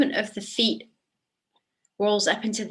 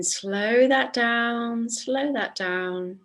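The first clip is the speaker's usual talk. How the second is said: slow, drawn-out winding-down cue; 3 semitones higher, much slower than usual. Shown as a demonstration. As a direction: stretch the words out to ease the movement to a stop. Slowing the class's movement down.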